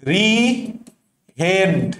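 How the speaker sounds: রিহেন্ড very slowly, as two separate pieces; split into syllables. Shown as a demonstration. Teacher splitting a word for its spelling, slow separate syllables. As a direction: pause between the halves of রিহেন্ড. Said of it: "অ্যাপ্রিহেন্ড বানানোর আগে একটা অ্যাপ আছে".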